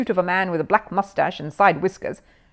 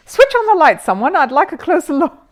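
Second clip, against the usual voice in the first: louder, higher call for light; 9 semitones higher, 9 dB louder than usual. Acted. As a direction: call across the room for the light. Mr Brown calls for the light.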